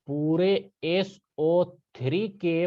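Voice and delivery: very slow, each symbol spelled out for emphasis; slow down so the class takes it in; slow teacher emphasis on पूरे एस ओ three के. Shown as a demonstration. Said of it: "ये बात ध्यान रखना".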